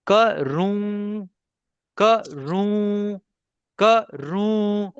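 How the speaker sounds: karu split slowly, second syllable held high into the nose; nasal ending demo. Shown as a demonstration. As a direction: model karu drawn out and sustained. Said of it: "Okay, I say".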